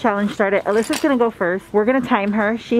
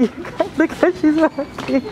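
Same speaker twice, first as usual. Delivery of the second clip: laughing; 5 semitones higher than usual. Real, not acted. Laughing at friend's slow walk.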